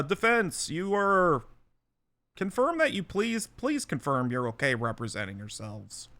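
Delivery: ordinary talk, nothing marked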